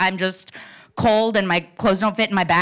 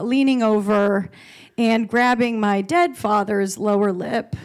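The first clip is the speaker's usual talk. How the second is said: usual speaking voice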